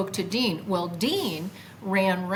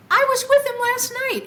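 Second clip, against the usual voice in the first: much higher and louder; emphatic disbelief; 8 dB louder than usual. Retold or imitated. Telling Dean she was with Jesus, high emphatic. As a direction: insist it really happened.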